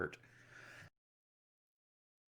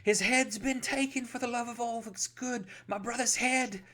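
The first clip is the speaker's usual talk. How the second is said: hysterical. Acted. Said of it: "He's like / with hysteria in his eyes".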